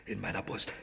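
pained and frightened; terrified whisper. Acted. Whispering in fear to a partner about the pain in the chest.

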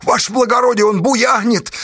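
high, complaining whine; tattling in a high, pitiful voice; plaintive wail. A narrator's reading. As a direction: wail pleadingly to a superior. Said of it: "жалобно заголосил писарь".

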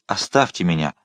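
ordinary delivery, nothing marked

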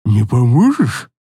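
low slow grunting voice; ugly creature asking sweetly; croaking fairy voice. Acted. Same fairy voice.

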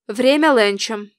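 slow, firm dismissal; understated curt refusal; curt cutting-off. A narrator's reading. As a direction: cut them off firmly. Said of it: "отрезала она".

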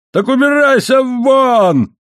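very high, slow, stretched scream; beside himself, yelling. A narrator's reading. Husband beside himself orders wife out, screaming. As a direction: scream the order at full pitch, holding the last word. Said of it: "вне себя заорал Моррелл".

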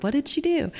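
high, eager mock question; eager curiosity. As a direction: imitate her own eager wondering. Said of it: "I'm like".

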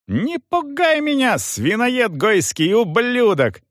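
high-pitched furious insult; defiant rage. A narrator's reading. Young man defies soldier's warning, enraged. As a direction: spit defiance in a strained high voice.

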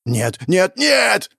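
building to a high cry; horrified denial. Acted. Hero seeing Ariana's house collapse, horrified. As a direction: climb to a desperate last word.

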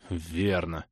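low, quiet, forced reply; strained agreement. A narrator's reading. Crusader grudgingly agreeing with the thief, strained and low. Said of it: "с натугой кивнул крестоносец".

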